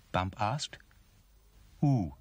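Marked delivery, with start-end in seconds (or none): 1.8-2.1 higher, hooted who; owl's hoot. A narrator's reading. Voice in the tree replies, hooting. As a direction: hoot the word like an owl. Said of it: "said the voice again".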